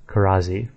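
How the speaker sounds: "Karazi" with a buzzing Z; correct way. Shown as a demonstration. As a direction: say "Karazi" with a voiced Z in the middle. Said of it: "the S is pronounced as a Z".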